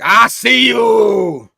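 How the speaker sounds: loud, slow, drawn-out villain shout; slow booming villain threat; menacing shout. Acted. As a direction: bellow it as a taunt. Villain confronts Spider-Man.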